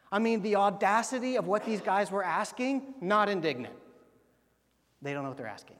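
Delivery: ordinary delivery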